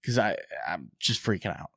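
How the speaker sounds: ordinary talk, nothing marked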